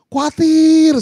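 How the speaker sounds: very high, loud drawn-out cry; exclaimed worry. Acted. Preacher crying out the worry to the congregation, drawn-out exclamation. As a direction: stretch the word high and loud.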